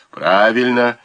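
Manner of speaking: a lifted, jaunty retort; cheerful. A narrator's reading. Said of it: "весело ответил Вялис".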